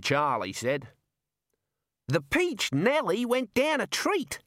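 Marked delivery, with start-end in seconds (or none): 2.1-4.4 high, drawn-out proud announcement; beaming with pride. A narrator's reading. Chef tells Rincewind of a success, beaming proudly. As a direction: beam it out proudly, slow and high. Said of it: "beaming proudly".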